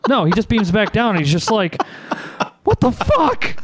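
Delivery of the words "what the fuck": high, incredulous what the fuck; a hammed-up shocked yelp; mock bewildered outburst. The man with feet for hands, high mock disbelief.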